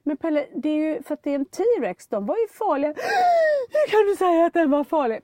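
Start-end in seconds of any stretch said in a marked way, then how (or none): 2.9-5.2 gasp, then a high, loud crying wail; mother imitating son's outraged crying; offended crying child. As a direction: mimic a boy deeply offended and sobbing. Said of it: "Han blir så kränkt".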